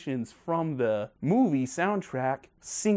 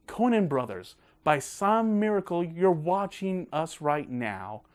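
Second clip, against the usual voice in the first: ordinary talk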